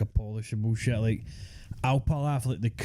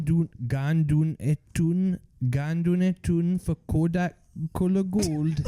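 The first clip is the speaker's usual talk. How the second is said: higher, slowed Geordie accent, hammed up; mock Geordie accent; 5 semitones higher than usual. Mates joking about an old Geordie advert, mock Geordie accent. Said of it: "Is that a Geordie accent?".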